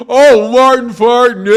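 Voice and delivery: loud, high, slow teasing punchline; mocking schoolkid. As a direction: crow the rhyme like a third grader. Kids teasing him about Martin.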